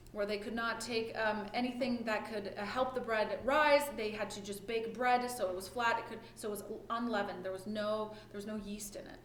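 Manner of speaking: ordinary talk, nothing marked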